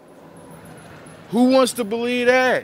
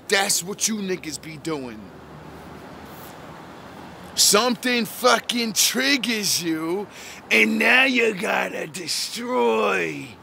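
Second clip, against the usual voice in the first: usual speaking voice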